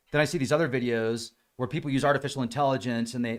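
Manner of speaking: ordinary talk, nothing marked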